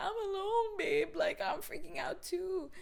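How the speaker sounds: very high, squeaky commiseration; mock panic. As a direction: squeal it in shared hammed-up panic. Panicked bonding with neighbour.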